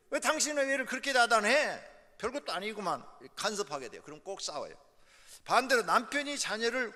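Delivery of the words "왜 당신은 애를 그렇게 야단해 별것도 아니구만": sharp, raised complaint at the wife; husband butting in. Acted. Husband interrupting his wife's scolding of their child, irritated and raised. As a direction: object loudly to how she scolds the kid. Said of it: "아내가 자녀를 훈계할 때 남편들이".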